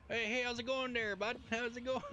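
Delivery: put-on high, bouncy Ontario valley accent, friendly; thick Canadian accent. Explaining her region's accent to a gamer friend, a thick Canadian accent. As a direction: ham up a folksy Canadian valley greeting. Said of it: "thicker accent".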